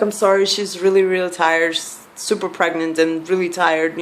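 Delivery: ordinary talk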